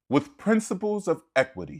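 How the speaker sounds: high, prim mock-polite quote of the justice; mock-sweet legal voice. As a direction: sneer it in a sugary high voice. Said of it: "she says".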